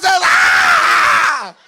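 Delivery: loud screaming ah; kids' scream. Retold or imitated. Kids screaming at the fight.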